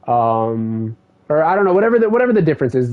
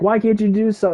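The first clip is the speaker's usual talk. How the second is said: yelling at them; 8 semitones higher than usual. Retold or imitated. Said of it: "he's yelling at them".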